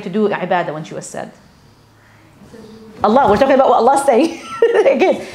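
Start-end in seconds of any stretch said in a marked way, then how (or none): 3.0-5.3 loud, higher-pitched, laughing through the words; real amused exasperation. Real, not acted. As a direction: correct them while laughing out loud. Laughs at audience's answer, gently correcting.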